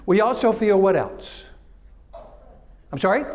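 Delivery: ordinary talk, nothing marked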